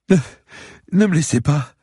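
high, panicked stammer; stammering plea. A narrator's reading. Old lady begging not to be left, stammering. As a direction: stumble into a loud, high plea. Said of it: "bafouilla-t-elle".

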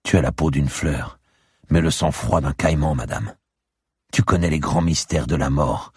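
solemn seer warning, rising in pitch; lightly voiced old clairvoyant, growing insistent; old seer neighbour. (vieille voyante solennelle qui met en garde)

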